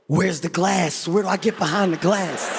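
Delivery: low, slow, drawn-out dread. Retold or imitated. Visitor hearing gorillas are here, asking in mild acted dread.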